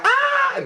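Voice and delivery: sudden very high squeal. Real, not acted. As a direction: let out a shrill, delighted squeal. Squeal after the joke.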